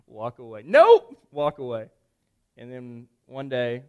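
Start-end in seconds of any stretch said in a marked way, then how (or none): 0.6-1.2 loud, high-pitched balk; abrupt refusal. Retold or imitated. Man backing out of apologizing, high loud refusal. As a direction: yelp it and bail out. Acted balking before the apology.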